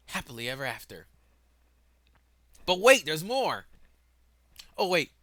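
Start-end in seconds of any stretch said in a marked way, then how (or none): 2.7-5.1 loud, high announcer-style burst; mock sales pitch. Speaker interrupting the galaxy plan with more to tell, mock sales-pitch shout. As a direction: sell it big, pitched right up.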